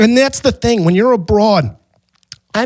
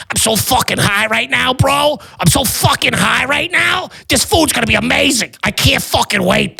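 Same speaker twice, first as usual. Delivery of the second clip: lightly voiced stoned bro, pitched up; a hint of an over-excited stoner; 6 semitones higher than usual. Stoned tourist raving to his cousin, mock bro voice. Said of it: "getting stoned, loud".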